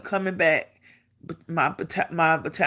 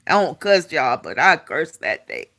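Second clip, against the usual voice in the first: ordinary delivery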